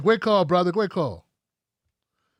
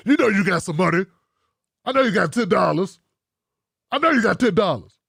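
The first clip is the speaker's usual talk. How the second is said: a lightly voiced, louder bully demanding money; mock Umar shakedown; 4 dB louder than usual. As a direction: push like a schoolyard bully shaking someone down. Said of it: "He be Debo-ing my brothers and sisters".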